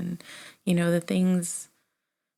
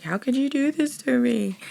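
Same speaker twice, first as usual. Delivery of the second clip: higher, louder tearful accusation; retold crying outburst; 7 semitones higher, 5 dB louder than usual. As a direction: replay the teary cry at her husband, half-acted. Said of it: "I just started crying".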